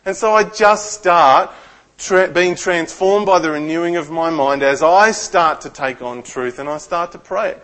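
normal talking voice

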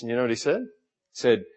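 normal talking voice

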